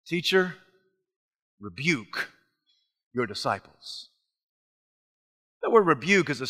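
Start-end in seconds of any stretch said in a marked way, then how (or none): 0.1-4.1 low, soft disapproving request; a touch of Pharisee disdain. Retold or imitated. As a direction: grumble at the teacher. Pharisees complaining quietly.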